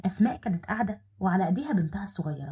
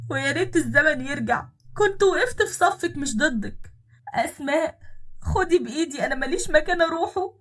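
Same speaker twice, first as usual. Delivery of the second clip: higher, louder begging, slowing on the last regret; desperate plea; 7 semitones higher, 4 dB louder than usual. Acted. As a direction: plead as if with nowhere to go. Repenting woman begs to be taken in. (توسل يائس بصوت أعلى)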